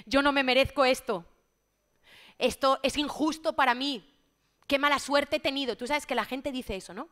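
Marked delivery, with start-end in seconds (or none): 0.1-5.8 loud, mocking imitation of self-pitying complainers; mock indignant complaint. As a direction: protest loudly, imitating the shameless. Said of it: "como dicen los sinvergüenzas". (queja indignada, imitada en voz alta)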